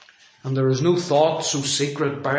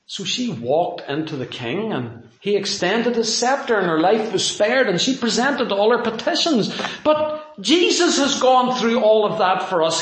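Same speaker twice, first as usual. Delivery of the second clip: ordinary talk, nothing marked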